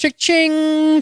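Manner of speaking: cha-ching flung up high like a till; playful high-pitched cash register sound; mock cha-ching. Cashing in on a cue.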